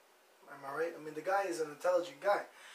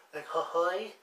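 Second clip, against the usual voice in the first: mock hurl sound; 3 dB louder, slower than usual. Shown as a demonstration. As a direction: fake retching noise. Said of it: "That's how I hurl".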